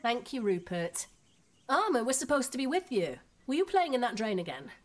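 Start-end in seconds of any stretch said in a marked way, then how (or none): 1.7-4.7 higher, louder telling-off; scolding mother. Acted. Mother questions her boys about playing in the drain, scolding. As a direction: take the children to task.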